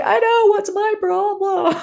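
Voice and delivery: very high, squeaky self-mocking voice; sheepish inner self. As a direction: squeak it out, embarrassed at yourself. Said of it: "two people".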